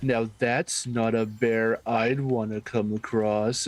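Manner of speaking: slow, drawn-out narrator impression; Chills. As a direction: stretch it like an awkward scripted narrator joke. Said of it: "He's like".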